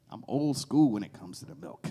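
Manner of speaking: ordinary talk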